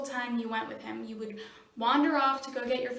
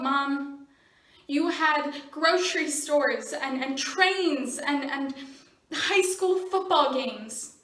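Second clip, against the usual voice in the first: louder, heated outburst at mom; angry accusation; 2 semitones higher, 5 dB louder than usual. Acted. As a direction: throw it at your mother as a grievance, voice raised. Teen lashes out at mother.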